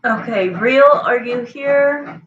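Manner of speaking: loud slow call to a viewer. Real, not acted. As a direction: call out, drawing it out. Calling out to a viewer.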